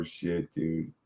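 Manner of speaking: ordinary delivery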